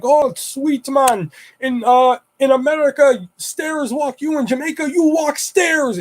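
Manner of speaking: put-on high-pitched, drawn-out Jamaican accent; Jamaican Rasta accent. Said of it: "I've been rostered the whole fucking time".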